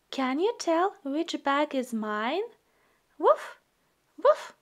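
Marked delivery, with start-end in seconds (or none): 3.2-4.5 high little puppy woof; puppy bark. Acted. Voicing the puppy Biscuit barking.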